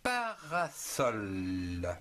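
Parasol stretched out slowly, syllable by syllable; very slow syllabified word. Shown as a demonstration. Teacher modelling Parasol slowly for children. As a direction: pull Parasol apart into its syllables. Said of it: "En trois syllabes".

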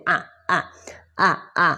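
"อ่ะ" as a short, clipped-off vowel; short U sound. Shown as a demonstration. Teacher shows learners the U sound, short demonstration. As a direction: say "อ่ะ" as separate short beats. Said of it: "เสียง U นะคะ เป็นเสียง".

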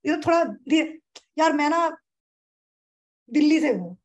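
long pause before Delhi से हूँ, then land it; यार मैं ना left hanging, दिल्ली से हूँ dropped in loudly; comedian's punch-line pause. Shown as a demonstration. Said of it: "देखा है ना कॉमेडियंस कैसे करते हैं".

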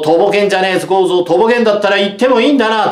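schoolboy bully picking a fight; taunting bully. A narrator's reading. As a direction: needle him, mock-threatening. Bully Noboru taunts Kozo.

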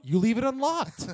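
high, loud defensive retort; mock-indignant high-pitched comeback; protesting excuse. Protests back to the mom.

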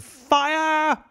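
very high, long, loud shriek of Fire!; the whole crowd screaming. A narrator's reading. The panicked crowd shrieks the alarm together. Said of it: "joined in a general shriek".

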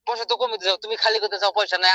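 ordinary delivery

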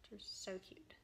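whispered and hushed, doting; soft whisper. Real, not acted. Stitcher gushing to viewers over cute chart, whispered. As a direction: breathe it out in a whisper like a secret.

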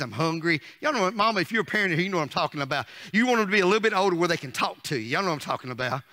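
ordinary talk, nothing marked

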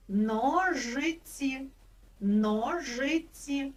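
Ножиці stretched out very slowly; slow spelling-out. Shown as a demonstration. Teacher stretching a word so children hear its sounds. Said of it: "Чи є тут звук з?".

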